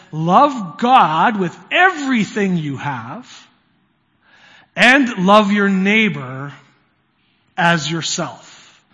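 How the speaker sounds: slow, emphatic recited answer; deliberate, weighty model answer; gold star answer. Acted. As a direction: recite it slowly like a Sunday school pupil. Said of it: "gives the gold star answer".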